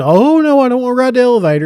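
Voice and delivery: put-on a little kid begging not to get on; whiny scared kid.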